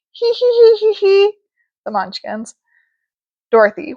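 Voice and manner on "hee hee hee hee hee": high tittering giggle; munchkin giggle. Acted.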